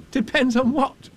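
put-on high eager boy's voice; a young boy pleading with Owl; urgent Christopher Robin. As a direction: rush to the rescue like a small boy.

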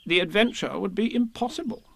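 high, solemn Rabbit; solemnly. A narrator's reading. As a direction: Rabbit making a grave pronouncement. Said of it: "said Rabbit solemnly".

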